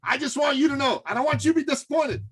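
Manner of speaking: high-pitched, repetitive buttonholing; insistent prospect. Retold or imitated. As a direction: imitate a know-it-all heading off a sales pitch. Acted prospect warning him off upsell.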